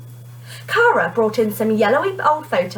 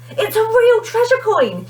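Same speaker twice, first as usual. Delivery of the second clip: high, beaming delight; a child showing off her treasure; grinning excited girl; 13 semitones higher, 4 dB louder than usual. A narrator's reading. Kerry shows the coin to Omar, grinning and excited. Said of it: "she grinned".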